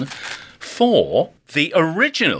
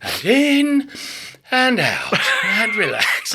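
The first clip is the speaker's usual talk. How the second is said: mock hypnotist; 5 semitones higher, slower than usual. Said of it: "we're back in the room".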